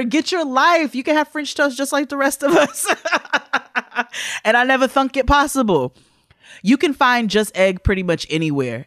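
high, bright-eyed sponsor pitch; excited ad read. Acted. Host gushing to listeners about sponsor breakfast, excited ad read. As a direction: sell the French toast with gushing delight.

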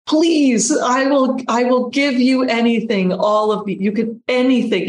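desperate plea. Retold or imitated. Retelling her prayer over her dying fiancé, pleading. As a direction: voice climbing high in desperate begging. Begging God at fiancé's deathbed.

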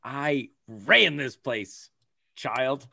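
sassy mock pride. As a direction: lord it over the staff, drawing it out. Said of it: "I'm like".

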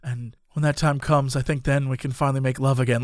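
low, soft, intense line reading; overly serious acting. Actress rehearsing love lines at a stranger, overly serious. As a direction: play it dead serious, low and hushed. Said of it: "trying to be very serious about acting".

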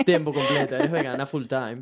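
laughing through the words; amused laughing echo. Real, not acted. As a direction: repeat her answer with a laugh. Laughing while repeating.

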